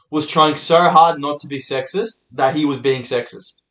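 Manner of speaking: usual speaking voice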